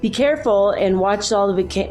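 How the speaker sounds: ordinary talk, nothing marked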